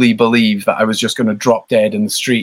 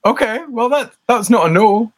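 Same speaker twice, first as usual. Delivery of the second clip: much higher, squeaky put-on voice of the band; mock hopeful reply; 11 semitones higher than usual. Band imitating themselves answering the label, high squeaky mock voice. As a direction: mimic your own bandmates clutching at hope, pitched way up. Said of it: "So we were like".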